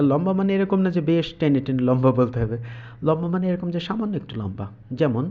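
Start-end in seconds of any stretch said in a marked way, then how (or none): none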